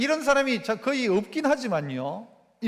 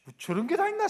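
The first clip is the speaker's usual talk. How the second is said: 1 exasperated father; 7 semitones higher than usual. Acted. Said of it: "아버지는 갑자기 막".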